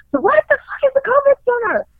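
high-pitched incredulous question; alarmed outburst. Retold or imitated. Daughter reacting to mom's covid center news, high-pitched and incredulous. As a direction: blurt it out in high disbelief.

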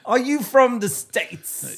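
high, slow mock British accent; hammed-up British guy. Friend performing the British guy as a skit, high and slow. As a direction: ham up the Brit cranked to ten. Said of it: "I was trying to be the guy".

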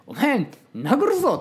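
high, loud imagined snap; a flash of mock fury at the beggar; a hammed-up threat, high and loud; mock angry threat.

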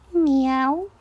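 high kitten meow; a small rising miaow; cat meowing. A narrator's reading. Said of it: "Kinyávogott".

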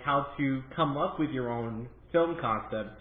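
normal talking voice

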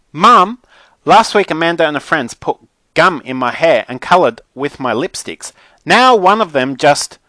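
high, loud griping to Mum; whining Mackenzie. A narrator's reading. Mackenzie tells Mum about gum in her hair, aggrieved. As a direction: build a loud tattletale complaint.

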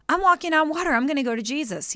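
proud, bright call of confidence; excited. Acted. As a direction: sing out like a proud walker. Peter acted, confident on water.

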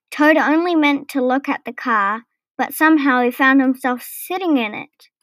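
usual speaking voice

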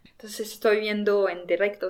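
usual speaking voice

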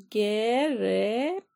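very slow, sound by sound; spelling out. Acted. Lizzie spelling cat for her aunt, slowly spelling out. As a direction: draw out each piece, rising at the end.

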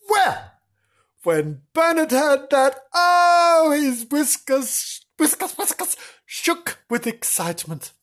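high, thrilled telling; excited narration. A narrator's reading.

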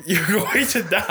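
laughing. Real, not acted. Laughing at friend.

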